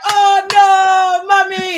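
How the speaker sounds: high, loud Nollywood-style wail; mock wailing shout. Imagining Mufasa's death in a Nollywood movie, loud mock wail. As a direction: wail it like a grieving Nollywood mother. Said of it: "like they do in the West African movies".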